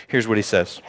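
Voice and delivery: ordinary talk, nothing marked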